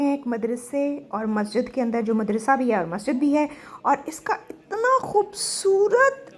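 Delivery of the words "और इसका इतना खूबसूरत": awestruck. Real, not acted. Awed by mosque artwork.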